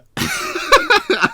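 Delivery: squealing high laugh that falls away. Real, not acted. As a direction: lose it completely, laughing high. Breaks down laughing mid attempt.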